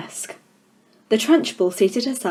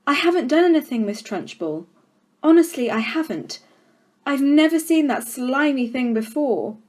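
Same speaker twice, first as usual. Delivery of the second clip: higher, fast, frightened protest; a schoolgirl protesting her innocence to the headmistress; pleading girl; 6 semitones higher, 4 dB louder than usual. Acted. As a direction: beg to be believed.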